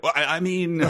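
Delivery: high, flustered "Well, I, I mean"; stammering, caught out; flustered backpedal. Pretend. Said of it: "a mistake where you're like".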